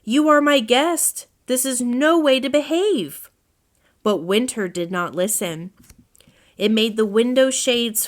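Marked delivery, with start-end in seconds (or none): 0.0-3.3 raised, higher voice telling off a rude guest; indignant owl scolding his guest; scolding host. A narrator's reading. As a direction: scold him firmly for his manners.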